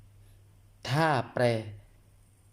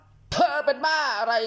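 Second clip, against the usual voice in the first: much higher, louder shouted retort; shouting back; 5 dB louder, slower than usual. Acted. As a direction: yell it back at an angry partner. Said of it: "พร้อมตะโกนกลับไปว่า".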